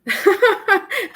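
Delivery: bright high laugh bursting out; high burst of laughter. Real, not acted. Laughing at a viewer's comment.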